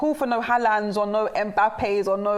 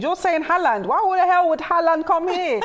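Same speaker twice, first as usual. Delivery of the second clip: raised, high-pitched incredulous challenge; 7 semitones higher than usual. Real, not acted. Pundit scoffing at Arsenal fans wanting Haaland, incredulous. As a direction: pitch jumps up in real disbelief.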